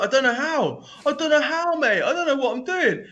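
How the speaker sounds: high-pitched mock-panicked pleading; panicked protest. Novice telling biker he can't ride, mock panic.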